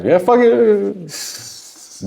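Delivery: put-on high, slow drunken yeah fuck it; drunk shrug. Drunk rapper brushing off the engineer, slow and high. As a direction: drag it out like a careless drunk. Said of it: "My drunk ass".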